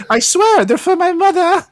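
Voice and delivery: put-on high-pitched British child; British accent. Kid swearing to the clerk they're for mother, British accent. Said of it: "where'd you get that British accent".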